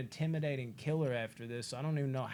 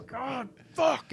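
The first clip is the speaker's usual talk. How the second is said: pained curse; 12 semitones higher, 6 dB louder than usual. Retold or imitated. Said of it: "and he's like".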